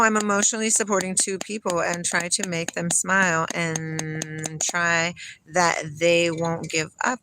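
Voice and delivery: ordinary talk, nothing marked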